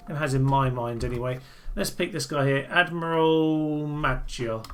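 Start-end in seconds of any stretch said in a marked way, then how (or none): 2.7-4.6 slow typing read. Real, not acted. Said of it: "I believe that should be in quotation marks".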